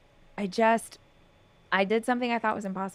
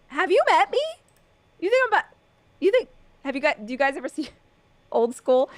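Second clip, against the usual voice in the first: much higher mock indignant quote; incredulous; 6 semitones higher, 3 dB louder, faster than usual. Retelling her text to boyfriend, higher mock indignant. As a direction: act offended someone doubted you. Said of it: "I was like".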